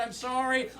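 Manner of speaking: ordinary talk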